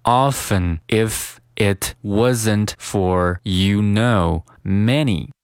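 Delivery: word by word, very slowly; careful, spaced-out teaching pace; slow model reading. Shown as a demonstration. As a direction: read it out slowly for learners. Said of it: "我们先来读一下".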